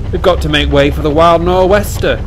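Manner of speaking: loud, high-pitched call to a workmate. A narrator's reading. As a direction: call it out urgently over the engine. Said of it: "he called to the driver".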